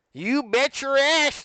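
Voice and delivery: high, loud, drawn-out exclamation; hammed-up high holler; emphatic holler. Shown as a demonstration. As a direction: belt it out slowly with relish. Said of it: "it's supposed to be like".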